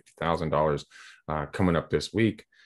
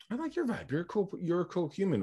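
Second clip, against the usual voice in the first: much higher, softer, playful gushing; mock gushing reply; 10 semitones higher, 5 dB quieter than usual. Answering the stranger's compliment, in a high, playful gushing voice. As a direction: echo her gush back in a high voice. He quotes himself replying to her.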